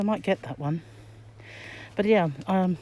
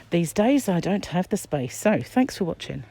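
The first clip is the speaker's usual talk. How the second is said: loud, higher bright goodbye; cheery sign-off; 5 semitones higher, 6 dB louder than usual. Real, not acted. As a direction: wave them off brightly.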